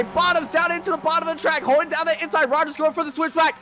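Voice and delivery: rising, frantic play-by-play shout; excited race call. Real, not acted. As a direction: call the finish at full voice.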